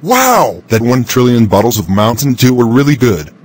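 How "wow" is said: high, delighted wow; amazed wow. Acted. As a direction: gasp it out in delight. Reacting to the drink.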